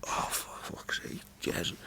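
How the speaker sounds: slow exasperated whisper. Retold or imitated. Groaning at the TV character he resembles, slow whisper. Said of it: "just certain bits I go".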